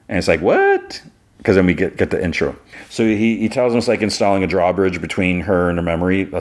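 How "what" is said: a sudden high squeak of disbelief; shocked what. Retold or imitated. Stunned brother reacting to the doctor's confession, high shocked what. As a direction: jump way up in pitch, stunned.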